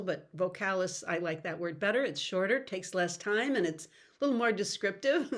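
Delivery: normal talking voice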